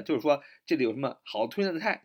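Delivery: ordinary talk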